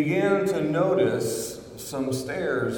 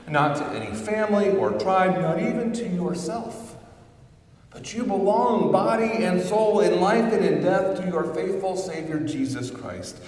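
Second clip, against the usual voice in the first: higher and louder, preaching in full voice; rising preacher's cadence; building sermon climax; 8 semitones higher, 4 dB louder than usual. Acted.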